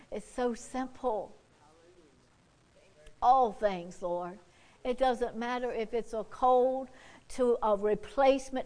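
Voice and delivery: soft, hushed prayer to the Lord; quiet prayer. Acted. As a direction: pray low and intimate. Preacher turns to quiet prayer.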